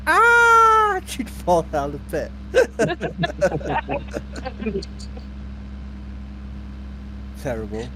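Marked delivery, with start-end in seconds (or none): 0.1-0.6 very high, loud cartoon scream; mock falling scream. Dad acting out daughter falling, high scream. 2.4-7.4 airy high giggle; helpless wheezing laughter; high breathless laugh. Real, not acted. Dad recalling game with kids, wheezing laugh. Laughing after pit story.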